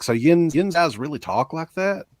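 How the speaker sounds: a touch of Pittsburgh dialect, incredulous question; imitated Pittsburgh accent. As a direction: imitate a Pittsburgher asking in disbelief. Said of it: "he's from Pittsburgh. He's like".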